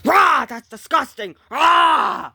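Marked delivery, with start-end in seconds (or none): 0.0-0.5 loud, high "rah" yell; disgusted roar. Acted. Reacting to a tentacle coming off, roaring. 1.5-2.3 loud, high "rah" yell; disgusted roar. Acted. Reacting to a tentacle coming off, roaring.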